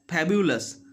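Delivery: FABULOUS said as "pabulous"; F made a P. Shown as a demonstration. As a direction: lips closed for a P at the start of FABULOUS. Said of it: "But this is wrong".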